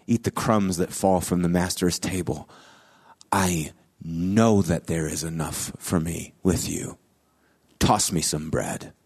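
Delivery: mother answering Jesus in a hushed, humble voice; quiet humble plea. Retold or imitated. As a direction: ask softly, with quiet faith. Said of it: "this is what she says".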